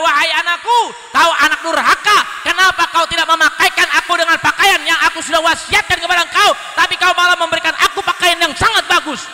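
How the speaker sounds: loud, high scolding mother's voice; dead mother scolding. Acted. Mother in a dream rebuking her son, loud and stern. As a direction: reproach the undutiful son from the grave. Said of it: "apa kata orang tuanya".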